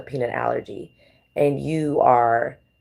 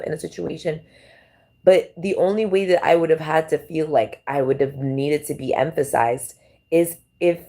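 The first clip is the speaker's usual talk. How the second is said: normal talking voice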